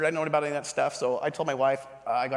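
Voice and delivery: usual speaking voice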